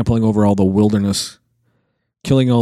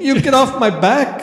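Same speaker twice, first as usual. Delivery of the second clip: pitched way up in mock protest; 13 semitones higher than usual. Beatle telling [name] to back off, high mock voice.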